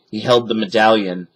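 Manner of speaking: ordinary delivery, nothing marked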